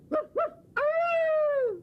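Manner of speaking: very high slow drawn-out woof; dog barking. Pretend. Child howls for the dog at the window. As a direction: howl it like a puppy. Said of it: "The dog barked".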